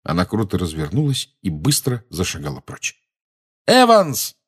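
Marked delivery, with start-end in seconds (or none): 3.7-4.4 high, loud call; urgent raised call; calling after her. A narrator's reading. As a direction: shout the name after someone leaving. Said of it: "крикнул Джеймс ей вслед".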